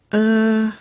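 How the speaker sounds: high, held hesitant filler; stall, caught out; faltering. A narrator's reading. Woman caught out by her sister's question, faltering. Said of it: "Waverley faltered".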